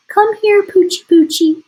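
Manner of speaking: high, loud sing-song coaxing; cooing to a puppy. Acted. Burglar coaxes the dog over, high coaxing coo. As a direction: call the dog sweetly like baby talk. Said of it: "whispered the other".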